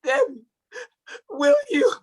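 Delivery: lightly voiced sweet-talking husband; a hint of a pleading suitor; husband proposing. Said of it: "He's like".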